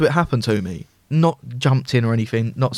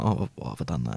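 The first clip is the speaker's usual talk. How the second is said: much softer and lower, muttered to yourself; hushed regret after losing your temper; quiet self-reproach; 11 semitones lower, 11 dB quieter than usual. Retold or imitated. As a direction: kick yourself under your breath. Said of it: "I'm like".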